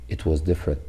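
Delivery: ordinary talk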